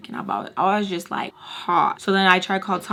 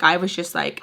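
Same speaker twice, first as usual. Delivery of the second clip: normal talking voice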